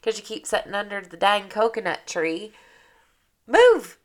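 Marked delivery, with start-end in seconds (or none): 3.5-4.0 high, loud shout; sudden loud high yell of move; shouted command. Acted. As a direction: yell at them to get out of the way. Yelling at coconut sitter.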